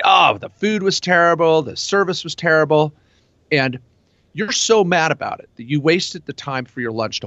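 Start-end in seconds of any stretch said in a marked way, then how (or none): 0.0-2.9 mock outraged complaint. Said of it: "you just unleash".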